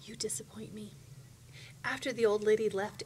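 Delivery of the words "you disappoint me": hushed, chilly whisper. A narrator's reading. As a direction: whisper it close to the ear. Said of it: "she whispered".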